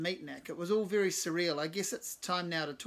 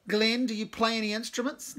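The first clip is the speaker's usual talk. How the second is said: higher, louder shout over the noise; 6 semitones higher, 8 dB louder than usual. Acted. Cave shouting at Glenn while fiddling with piano, loud. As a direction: shout over your own tinkering. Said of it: "Nick shouts".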